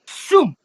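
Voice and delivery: sharp high whoosh; whoosh sound effect. Acted. GM voicing Amelia's launch, high whoosh. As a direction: zip the launch noise out.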